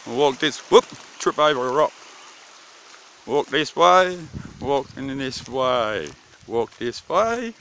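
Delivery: ordinary talk, nothing marked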